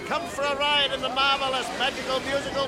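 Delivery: fairground barker. Acted. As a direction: drum up the crowd at the fair. Barker calling crowd.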